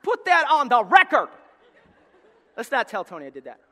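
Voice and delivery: loud laughing quip; laughing aside. Real, not acted. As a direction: laugh it out to the crowd.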